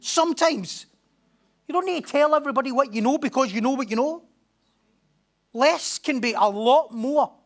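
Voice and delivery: loud, high, driving preacher's climax; preaching at full force. Real, not acted. Pastor urging the church on, preaching at full force. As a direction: drive it home to the congregation.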